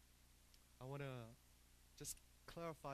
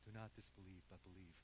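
gentle tone; 5 semitones lower, 8 dB quieter than usual. Acted. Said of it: "his tone of voice when he said".

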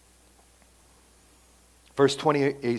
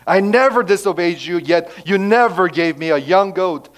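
louder, higher bitter complaint; resentful accusation; 6 semitones higher, 9 dB louder than usual. Acted. Older brother accusing his father, bitter and loud. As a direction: spit out the grievance. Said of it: "Resentment. Jealousy".

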